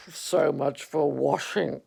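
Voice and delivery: slow, dry donkey grumble; Eeyore. Acted. Eeyore remarking on the accident, slow and dry. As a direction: drag it out, unimpressed. Eeyore after Roo falls in.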